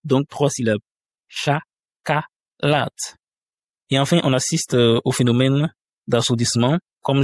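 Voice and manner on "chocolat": Chocolat stretched very slowly into three syllables, shakala. Shown as a demonstration. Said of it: "On a trois voyelles, donc trois syllabes".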